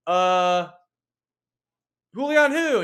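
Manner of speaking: baffled, not placing the name; confused. Retold or imitated. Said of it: "And he's like".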